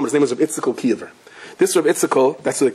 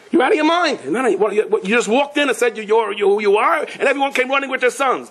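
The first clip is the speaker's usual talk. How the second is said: pitched much higher, berating in disbelief; exasperated scolding; 9 semitones higher than usual. Retold or imitated. Wife scolds husband for the wild match, exasperated and high. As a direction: tell him he's lost his mind.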